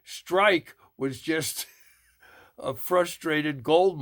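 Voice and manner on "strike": mock yell. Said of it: "his neighbor who kept yelling".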